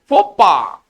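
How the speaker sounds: फोपा said firmly, with relish; French pronunciation. Shown as a demonstration. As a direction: savour the word फोपा. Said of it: "ये पढ़ने में भी थोड़ा सा अच्छा लग रहा है". (फोपा मज़े से, ज़ोर देकर)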